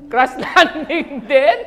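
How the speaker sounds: slow, loud, laughing it out; laughing question. Real, not acted. Teasing a viewer about his K-drama, laughing. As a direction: stretch the title through laughter.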